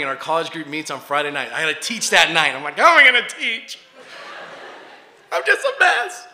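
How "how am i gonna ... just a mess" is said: high, loud wail rising to a squeal; comic sobbing meltdown; mock tearful despair. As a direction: wail it as a hammed-up wreck. Retells weeping despair before teaching.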